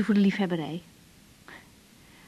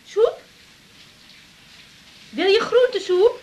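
high call to someone in another room; asking across the house about soup; 10 semitones higher, slower than usual. Acted. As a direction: call it up the stairs, pitched high.

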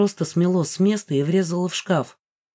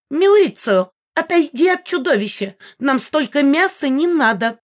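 put-on high, loud old lady calling; old woman's voice; 9 semitones higher, 4 dB louder than usual. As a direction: scold the cat like a granny. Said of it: "послышался старческий голос".

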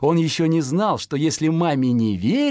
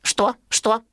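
higher chirpy question; higher baby-bird voice; curious fledgling; 10 semitones higher than usual. Acted. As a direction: ask like a little sparrow. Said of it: "Пудик спрашивает".

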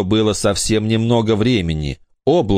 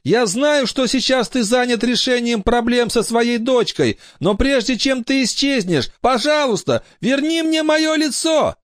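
put-on very high pleading boy. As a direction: ask it as a young boy. Brandon pleads.

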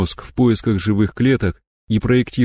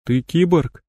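shocked; 7 semitones higher, slower than usual. Acted. Shocked discovery of cyborg.

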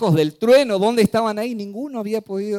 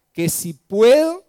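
incredulous echo; 5 semitones higher, 7 dB louder, slower than usual. Acted. Jesus echoes the father's doubt.

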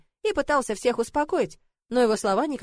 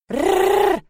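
high, loud rolled growl; child's growl; 10 semitones higher, 8 dB louder than usual. A narrator's reading. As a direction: growl high like a little girl playing beast. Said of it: "прорычала Соня". (звонкое детское рычание)

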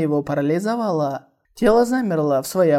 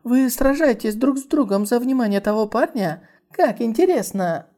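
joyful; 7 semitones higher than usual. Acted. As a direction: bubble over with delight. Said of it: "радостно сказала".

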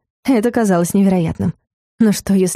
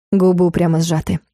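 ordinary delivery

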